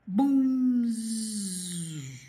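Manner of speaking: loud boom into long fading buzz; burst, then let the air leak out; drawn-out deflating tire noise; imitated tire blowout.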